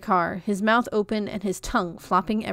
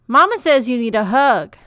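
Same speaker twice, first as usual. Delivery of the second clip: higher and louder, carrying across the yard; loud calling; 4 semitones higher, 11 dB louder than usual. A narrator's reading. Peach calling his mama's message to Comfort, loud. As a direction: call it after her as she walks away.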